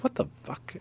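hushed retold aside, barely voiced; muttered to himself. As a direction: think it aloud under the breath. Inner thought as coach approaches.

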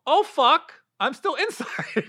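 high, laughing shocked gasp; mock astonishment; surprised realization. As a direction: discover the roof in disbelief. Realizing he is still indoors.

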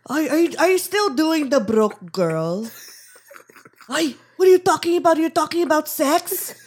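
put-on thick Filipino accent. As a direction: play a scandalized Filipino dad. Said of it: "I always have to channel my dad".

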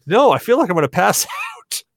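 high-pitched mock panic, breaking into laughter; imitated scared. Shaken kid answering are you all right, mock panic. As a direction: play the rattled kid, laughing at the end. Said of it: "he's scared".

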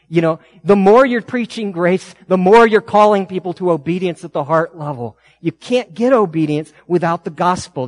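loud preaching drive; preacher pressing the congregation, loud; full-force preaching. Acted. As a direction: drive the point home from the pulpit.

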